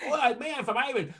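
put-on a light Irish accent, quick and high; mock Irish accent. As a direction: imitate an Irish voice in passing.

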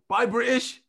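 playful slogan shout. Real, not acted. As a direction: call it out like a campaign slogan. Teasing friends about Brexit.

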